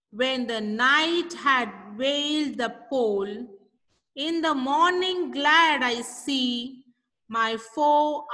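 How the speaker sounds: slow, measured poem reading; poem recitation. Acted. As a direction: read each line slowly and deliberately, like reciting to a class. Teacher recites poem slowly.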